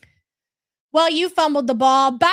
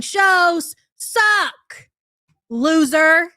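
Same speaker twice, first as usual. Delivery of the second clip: louder, punched insult; angry insult; 3 semitones higher, 5 dB louder, much slower than usual. Real, not acted. Streamer insults Keemstar's show, loud and scornful. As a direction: spit out the last word.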